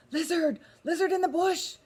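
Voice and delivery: high, loud urgent call. A narrator's reading. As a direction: call into the bush. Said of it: "he called quickly".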